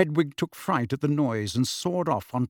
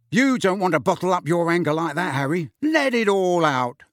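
loud, high mock counselling; mock him with big-brother cheek; mock advice; 5 semitones higher, 4 dB louder than usual. A narrator's reading. Fred mocks Harry's shouting.

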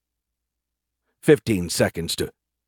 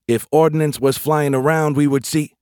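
high, louder rebuttal; argumentative protest; 7 semitones higher, 5 dB louder than usual. Acted. Private disputing squadmate's warning of ordnance, argumentative. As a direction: argue back with raised voice. Larry argues until cut short.

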